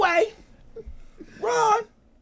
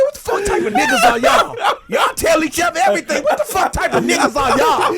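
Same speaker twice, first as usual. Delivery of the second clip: loud, incredulous mock yell; retold yell of disbelief; exasperated outburst; 4 dB louder than usual. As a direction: act out a friend blowing up.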